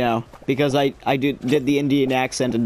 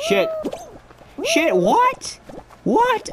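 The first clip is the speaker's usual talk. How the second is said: sudden high startled "what"; shocked yelp; slower than usual. Real, not acted. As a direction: jump way up in pitch in disbelief. Shocked at landing in game.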